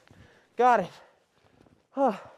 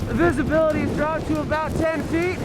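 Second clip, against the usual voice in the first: raised voice, high and strained; shouting over wind; 9 semitones higher than usual. Real, not acted. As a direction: call out over the gusting wind. Climbing in wind and snow.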